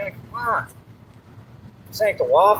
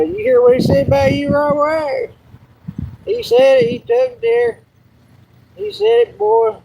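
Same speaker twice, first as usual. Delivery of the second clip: teasing hype; 8 semitones higher than usual. Pretend. Hyping up a joke about Rob Wack, high teasing. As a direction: egg him on, jeering.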